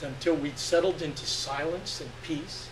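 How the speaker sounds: ordinary delivery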